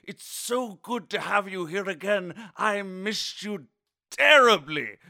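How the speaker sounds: mock-posh British host welcoming listeners; hammed-up British accent; little British accent. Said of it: "That was a little, little British".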